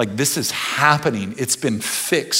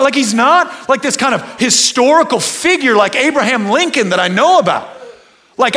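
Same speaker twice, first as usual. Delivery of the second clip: higher, louder fervent testimony; impassioned preaching; 12 semitones higher, 8 dB louder than usual. Acted. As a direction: pour out your love for Jesus loudly. Impassioned testimony of loving Jesus.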